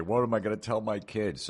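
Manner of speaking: lightly hammed-up worried parent; mock whiny parent. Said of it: "and the next day".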